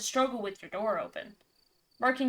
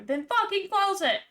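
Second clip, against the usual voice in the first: frustrated yell; 10 semitones higher, 7 dB louder than usual. Real, not acted. Yells at game character.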